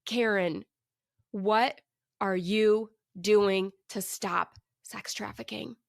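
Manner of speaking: slow, deliberate repeat, phrase by phrase; measured, insistent question with pauses; voice of God. Retold or imitated. God repeats his question to the bargaining woman, slow divine voice. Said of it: "he just repeats himself".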